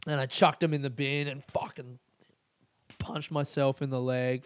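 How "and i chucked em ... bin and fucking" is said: ordinary talk, nothing marked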